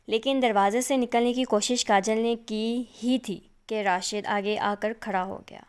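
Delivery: plain reading voice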